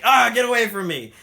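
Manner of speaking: mock shout. Diana shouts at the soldiers, mock panicked yell. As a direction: yell them off while holding the jeep.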